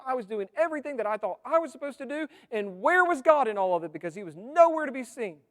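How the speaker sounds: loud, high, aggrieved challenge to God; a grieving man shouting his complaint at heaven; bitter accusation. Acted. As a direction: hurl the why questions at God as a wronged believer. Sufferer voiced, accusing God.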